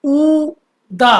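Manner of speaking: ऊ दा drawn out syllable by syllable; slow teaching read of ऊ दा; very slow spelling. Shown as a demonstration. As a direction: stretch ऊ long, then दा. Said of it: "ये क्या लिखा है".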